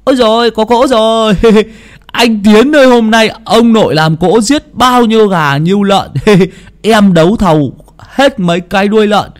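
put-on high, loud child voices with laughs; excited kids shouting. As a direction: shout gleefully like little boys expecting a feast. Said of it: "chúng nó hô to".

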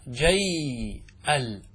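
"جي ال" very slow, jayyy... 'al; vowelless ya. Shown as a demonstration. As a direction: hold the y in "جي ال", then a separate 'al. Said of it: "انظر الياء ساكنة".

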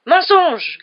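high, loud accusing cry; furious one-word contradiction; grating outburst. A narrator's reading. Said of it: "grinça Rusard".

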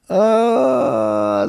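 high quiet anguished wail; quiet howl. A narrator's reading. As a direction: let out a soft moan of despair. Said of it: "завыл Мыкин тихо". (тихий вой)

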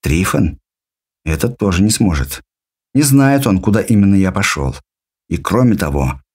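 ordinary delivery

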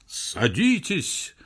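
slow, drawn-out loudspeaker call; megaphone howl. Acted. Rooftop loudspeaker howls to the crowd. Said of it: "завыл вдруг в рупоре".

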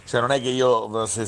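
ordinary delivery

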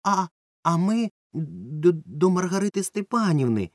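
slow, faltering, high stammer; caught-out boy stammering an answer; flustered mumble. A narrator's reading. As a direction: trip over the words in confusion. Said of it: "розгублено пробелькотів Ромка".

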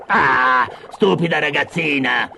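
irritated witch's scolding; exasperated witch outburst. Acted. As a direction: burst out at the girl as a cross witch. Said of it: "sbottò la strega". (strega che sbotta spazientita)

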